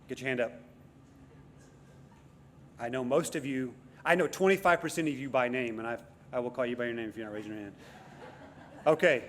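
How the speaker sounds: ordinary talk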